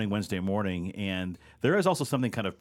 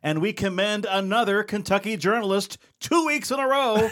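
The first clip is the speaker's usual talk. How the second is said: upbeat announcer read, raised and loud; show intro read; 8 semitones higher, 6 dB louder than usual. Acted. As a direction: sell the headlines like a radio host.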